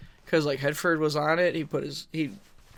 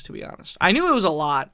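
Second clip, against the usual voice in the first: ordinary talk, nothing marked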